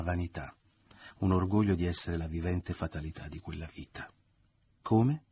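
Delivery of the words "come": high, rising affronted question; louder, offended query. A narrator's reading. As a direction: demand an explanation, taken aback. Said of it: "domandò la madre offesa".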